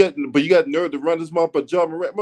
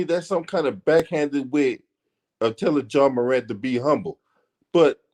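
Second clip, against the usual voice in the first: ordinary delivery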